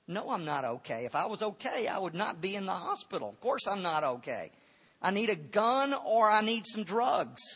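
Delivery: retold hospital-bed rant; exasperated patient. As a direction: play the fed-up patient, building to a high, loud demand. Acting out his outburst in hospital.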